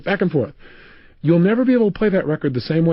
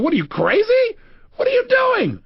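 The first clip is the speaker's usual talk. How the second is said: high-pitched mock shriek of indignation; shocked outrage. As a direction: imitate a drenched woman protesting in disbelief. Voices shocked woman after being drenched.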